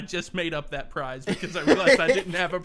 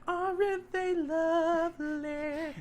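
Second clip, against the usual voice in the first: mock sweet voice; 12 semitones higher, much slower than usual. Mock quote, high and slow.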